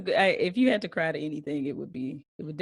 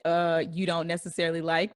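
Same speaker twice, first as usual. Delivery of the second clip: ordinary talk, nothing marked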